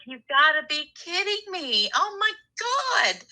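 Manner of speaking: high, near-tearful wail of disbelief; exasperated disbelief. Retold or imitated. Caller hears ex's boss offered him a job in her new town, high tearful wail. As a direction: cry it out like you can't believe your luck. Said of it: "And I was like".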